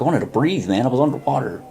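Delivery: high-pitched, incredulous retold protest; exasperated outburst. Young man tells the guru he needed air, acted exasperated outburst. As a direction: protest as if it were painfully obvious.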